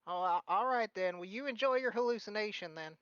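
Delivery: put-on high, humouring old lady; dismissive granny voice. Philia humouring Buster's hallucination, high granny voice. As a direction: brush him off like a grandma. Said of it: "She's like".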